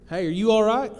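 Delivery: high, loud call across the alley. Retold or imitated. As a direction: call out to a stranger walking away. Josh calling to crying stranger.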